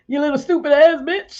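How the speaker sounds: high, loud outburst at a rival; excited cursing. Real, not acted. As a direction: blurt it out loud and high, carried away. Cursing at someone, then apologizing.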